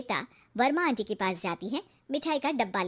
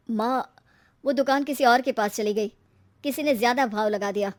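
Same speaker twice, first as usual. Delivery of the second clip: dejected son; 4 dB louder than usual. Acted. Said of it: "Rajesh बहुत दुखी होकर घर आता है".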